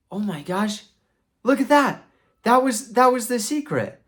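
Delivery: very high, excited mock astonishment; amazed discovery. Knight sees armor piece fall off, amazed. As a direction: marvel loudly in a sky-high voice.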